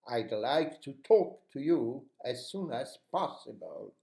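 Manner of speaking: "talk" and "possible" punched with open vowels; stress on talk and possible. Shown as a demonstration. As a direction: lean on "talk" and "possible", stretching both. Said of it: "we need to stress in this sentence, talk and possible".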